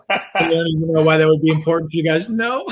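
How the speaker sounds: loud, through real laughter; words bursting out amid laughter; laughing. Real, not acted. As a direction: crack up at your own remark. Cracks up at own social plug.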